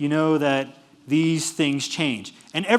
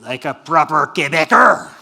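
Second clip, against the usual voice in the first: loud, forceful Quebecois accent; aggressive Quebecois accent; 10 dB louder than usual. Shown as a demonstration. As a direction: bark it like a proper Quebecer. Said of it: "That's an aggressive sounding accent".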